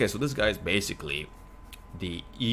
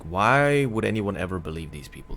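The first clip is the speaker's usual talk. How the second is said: ordinary talk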